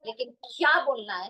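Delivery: ordinary talk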